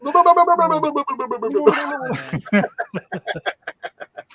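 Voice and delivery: a long, high fit of giggles; cracking up high and breathless; squealing laughter fit; high helpless laugh. Real, not acted.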